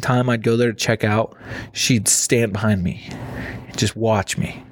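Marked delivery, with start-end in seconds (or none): none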